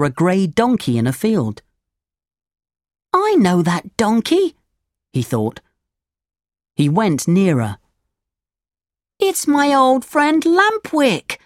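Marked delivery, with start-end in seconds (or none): 3.1-4.5 put-on high puppet boy voice; Pinocchio, thinking. 9.2-11.4 put-on high, drawn-out puppet boy cry; Pinocchio, crying out. A narrator's reading. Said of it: "he cried".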